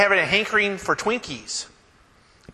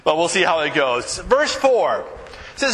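ordinary delivery

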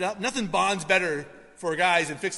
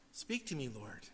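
hushed, much softer whispered-down plea to God; quiet prayer; 5 semitones lower, 12 dB quieter than usual. Shown as a demonstration. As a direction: pray it softly, almost to yourself, "Speak to me, Lord.". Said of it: "Have your perfect work in my life".

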